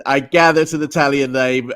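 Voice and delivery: usual speaking voice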